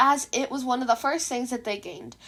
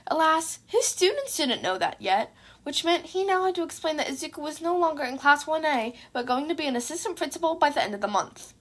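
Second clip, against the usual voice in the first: plain reading voice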